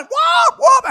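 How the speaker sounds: loud, high imitated siren; alarm whoops.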